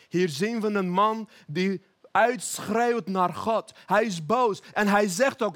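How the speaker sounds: normal talking voice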